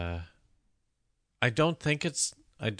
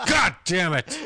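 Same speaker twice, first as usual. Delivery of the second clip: louder, higher comic outburst; hammed-up angry yell; mock exasperation; 7 semitones higher, 5 dB louder than usual. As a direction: burst out in mock frustration. Mock exasperation at own mistake.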